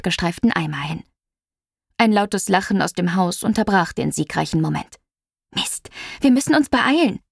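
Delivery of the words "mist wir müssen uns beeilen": higher-pitched hurried warning; urgent call. A narrator's reading. Narrator urges Juno to hurry to the hose, urgent call. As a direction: call to a friend to get moving. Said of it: "rief ich Juno zu".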